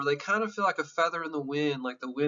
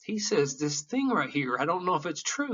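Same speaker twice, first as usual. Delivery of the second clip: usual speaking voice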